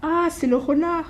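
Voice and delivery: high, bright call out; excited announcement. Acted. The mouse points out the fox to the gruffalo, excited. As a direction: point him out with glee.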